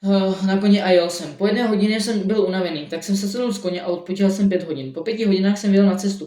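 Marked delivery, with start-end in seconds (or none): none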